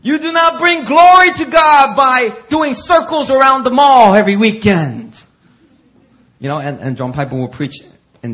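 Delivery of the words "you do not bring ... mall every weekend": much higher, louder exhortation; full-force preaching. Retold or imitated. Preacher rebuking complacent churchgoers, loud. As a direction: call them out hard.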